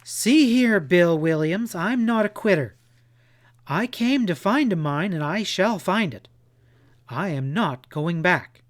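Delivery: loud, high, indignant outburst; angry defiance. A narrator's reading. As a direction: refuse to be sent home, loudly. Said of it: "her blue eyes sparkling with anger".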